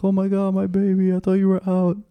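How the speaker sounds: high-pitched imitation of a fretting mother; mock a mom fussing over her baby; tearful mom. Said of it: "my mom was crying".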